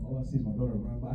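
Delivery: ordinary talk, nothing marked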